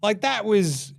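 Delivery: ordinary talk, nothing marked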